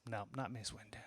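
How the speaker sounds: breathy, unvoiced whisper. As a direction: drop to a defeated whisper. Retold buzzer answer, whispered.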